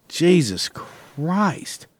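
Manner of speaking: a long, slow, fed-up groan of a curse; drawn-out exasperation. Real, not acted. Ranting about coworkers who shuffle, drawn-out exasperation. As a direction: stretch it out, utterly fed up.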